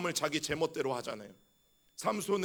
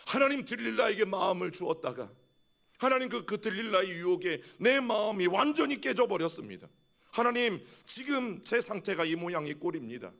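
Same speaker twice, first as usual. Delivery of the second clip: anguished prayer; 4 semitones higher than usual. Pretend. Acting out a repentant prayer.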